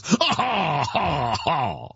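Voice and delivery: fake, falling "ha ha" laugh; mock consumer laugh. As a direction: laugh hollowly as the doubting consumer. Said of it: "says the consumer".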